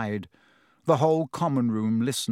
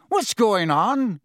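slow, louder, high-pitched call; startled question; 7 semitones higher, 5 dB louder, slower than usual. A narrator's reading. Seamus calling across the dark dormitory, startled.

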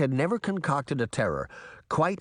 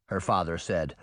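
usual speaking voice

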